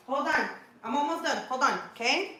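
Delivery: high, gentle parent voice; lifted, coaxing reassurance. Real, not acted. Puts child off while recording.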